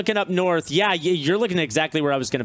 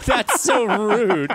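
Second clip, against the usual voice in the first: laughing; 6 semitones higher, 4 dB louder, much slower than usual. Real, not acted. Laughing at the joke.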